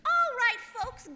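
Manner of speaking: hawking call. Acted. Salesgirl hawking to shoppers.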